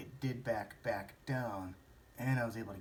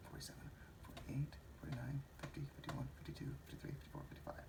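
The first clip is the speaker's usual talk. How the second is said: much softer, near-whispered counting; counting under breath; 3 semitones higher, 14 dB quieter, faster than usual. Real, not acted. Vlogger tallying the stock price to himself, counting under his breath.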